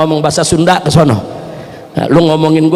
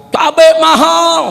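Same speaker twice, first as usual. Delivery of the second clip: high, slow mock cry of complaint; mothers crying out; 9 semitones higher, 3 dB louder, slower than usual. Preacher imitating mothers complaining about chili prices, high drawn-out cry. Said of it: "Ibu-ibu teriak".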